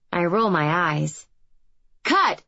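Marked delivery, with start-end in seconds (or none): 2.0-2.4 sharp shouted cut, higher; shouting. A narrator's reading. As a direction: bark the call across the set. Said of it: "shouts Jill".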